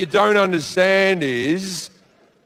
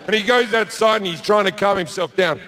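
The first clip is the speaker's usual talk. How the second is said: normal talking voice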